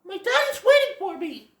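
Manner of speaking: put-on high, young fawn voice in a hurry; Bambi. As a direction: chirp it like a little fawn who has to run. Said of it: "Bambi's all like".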